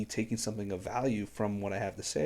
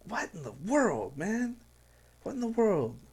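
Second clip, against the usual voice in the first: high incredulous cry; real shocked disbelief; 9 semitones higher than usual. Real, not acted. Shocked at the lyric.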